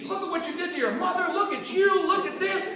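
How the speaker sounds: mock the dad's tirade; angry dad. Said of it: "he just went into me".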